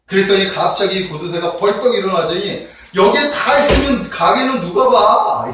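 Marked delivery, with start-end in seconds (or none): 2.9-5.5 high, loud, drawn-out mock shout; acted loud yell. Dying miser to his gathered family, yelling loudly. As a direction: yell it slowly as the indignant miser. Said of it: "소리를 크게 지르더니".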